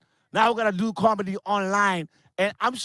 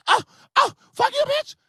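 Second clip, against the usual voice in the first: furious shriek. Retold or imitated. Woman in the club screaming at her rival, furious high shriek. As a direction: shriek it at the rival like the fight just broke out.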